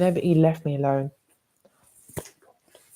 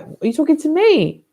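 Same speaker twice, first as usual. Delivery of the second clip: indignant challenge; 10 semitones higher than usual. Retold or imitated. Confronting racist neighbour.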